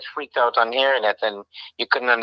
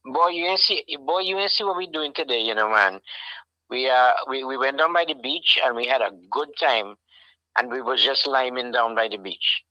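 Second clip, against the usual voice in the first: put-on a light Trinidadian accent, relaxed and easygoing. Dad shows the viewers his Trinidadian accent, lightly. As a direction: chat about the beach in a mild Trinidadian accent. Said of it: "say it in the accent".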